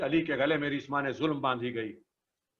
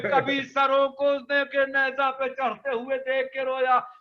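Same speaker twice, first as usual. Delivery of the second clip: full-force majlis lament; 10 semitones higher than usual. Acted. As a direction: wail the Karbala tragedy to the mourners. Lament continues.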